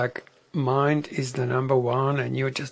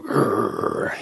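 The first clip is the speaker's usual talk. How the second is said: low imitated guard-dog growl; watchdog growl; 4 dB louder than usual. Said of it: "it's this watchdog".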